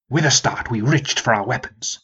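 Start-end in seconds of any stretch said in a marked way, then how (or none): none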